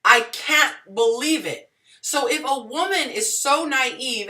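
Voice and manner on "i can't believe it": firm falling "I can't believe it" leaning on "can't", at normal pitch; flat, unimpressed disbelief; scornful disbelief. Shown as a demonstration. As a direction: say it like you think she's playing dumb.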